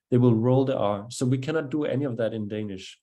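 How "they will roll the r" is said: ordinary delivery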